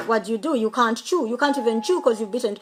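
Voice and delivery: normal talking voice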